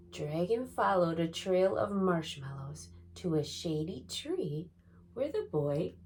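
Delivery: ordinary delivery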